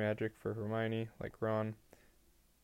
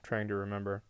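usual speaking voice

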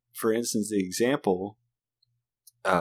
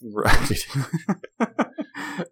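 exasperated retold reply; still pissed; 3 semitones higher, much slower than usual. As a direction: act out a fed-up dad giving in. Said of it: "I was still pissed".

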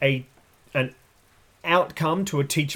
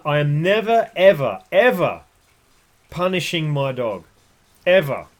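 ever punched louder each time; emphatic denial; 5 dB louder than usual. Real, not acted. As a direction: hammer home the word ever. Insists on a point.

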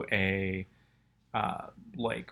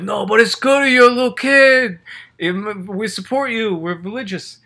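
mock church kids; 11 semitones higher, 11 dB louder than usual. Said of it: "They're like".

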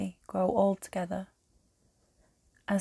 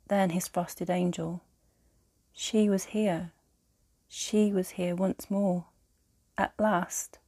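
plain reading voice

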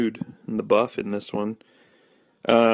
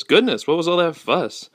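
higher, mildly acted puzzled dad; lightly voiced dad asking about the commotion; a hint of an exclaiming dad; dad's line; 7 semitones higher, 4 dB louder than usual. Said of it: "his dad says".

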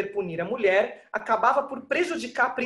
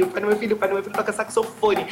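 usual speaking voice

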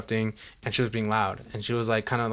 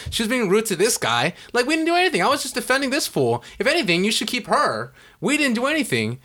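heated, higher-pitched protest building higher; retold angry outburst; 5 semitones higher than usual. Student defending a friend to the teacher, heated retold outburst. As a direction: cut in and argue your innocence, worked up. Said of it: "I yelled at her like that".

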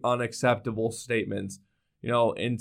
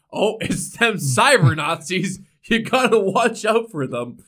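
mock paranoid voice; 7 semitones higher, 5 dB louder, slower than usual.

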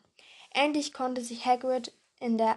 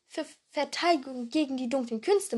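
ordinary delivery, nothing marked

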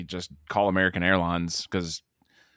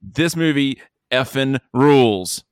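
slow, loud, emphatic; loud proclamation; 4 semitones higher, 5 dB louder, slower than usual. Real, not acted. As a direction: proclaim it like a hype man. Proclaims verdict loudly, slowly.

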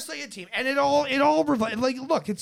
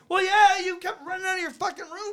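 retold yell at son; 11 semitones higher than usual. Father scolding his son.

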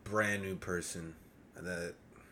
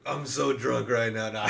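loud, higher laughing line; 4 semitones higher, 10 dB louder than usual. Real, not acted. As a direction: blurt it out through laughter. Joking mid-compliment, bursts laughing.

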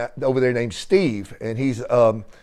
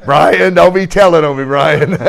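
laughing; 3 semitones higher, 5 dB louder than usual. Real, not acted. Laughing at friend's teasing joke.

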